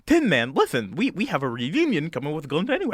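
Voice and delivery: coaxing Scarecrow King. Acted. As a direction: coax the Tin Man in a swooping character voice. Voices Scarecrow King figure.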